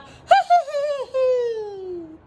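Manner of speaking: high, loud witchy hee-hee laugh; gleeful high-pitched witch laughter; witch's cackle. A narrator's reading. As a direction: cackle shrilly like a storybook witch, falling away at the end. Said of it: "The witch laughed out loud".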